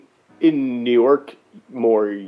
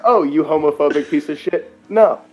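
higher, louder indignant retort; mock indignant; 5 semitones higher, 5 dB louder than usual. Retelling his retort to the homophobic guy, mock outrage. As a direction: snap back with mock outrage. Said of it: "but I was like".

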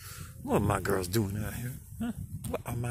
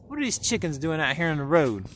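ordinary talk

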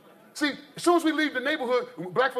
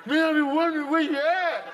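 high, gushing reunion voice, climbing as it goes; mock-emotional lion wailing to an old pal; overjoyed lion; 5 semitones higher than usual. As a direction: gush like a long-lost friend, hammed up. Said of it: "playing the lion like this".

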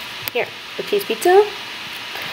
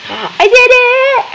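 excited; 14 semitones higher, 4 dB louder, much slower than usual. Real, not acted. First sale in the game.

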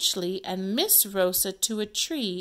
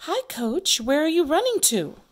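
higher, louder greeting; lively read-aloud character line; acted play character; 7 semitones higher, 7 dB louder, faster than usual. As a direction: call out a lively question, pitched up. Teacher acting out play.

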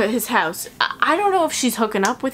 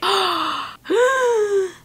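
big dramatic gasp; 11 semitones higher than usual. Acted. Dramatic gasp before naming Maria.

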